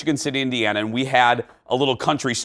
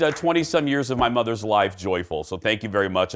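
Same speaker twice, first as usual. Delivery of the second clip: normal talking voice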